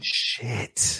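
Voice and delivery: whispered curse under the breath; softly cursing oneself; dismayed whisper. Real, not acted. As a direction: let the swear out in a soft hush. Dismay at the forgotten film.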